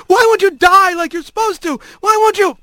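high-pitched shrieking hawk. A narrator's reading. Hawk to the rat who won't die, shrieking. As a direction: shriek it in frantic frustration. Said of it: "he could hear the bird shrieking".

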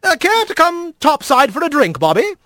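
high, breezy invitation; lilting, hearty captain's voice; jovial otter mayor. Acted. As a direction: invite him aboard like a genial skipper.

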